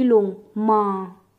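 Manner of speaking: माँ with the vowel stretched long and slow; teacher stretching माँ for the class; long drawn vowel. Shown as a demonstration. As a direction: pull out the आ in माँ slowly. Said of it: "हमारी आवाज़ खिंचेगी, long sound होगी".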